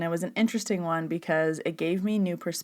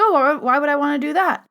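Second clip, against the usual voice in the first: imitating a baffled guy, much higher; clueless new guy; 8 semitones higher, 6 dB louder than usual. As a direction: swoop up high in mock puzzlement. Clueless new guy's question, retold.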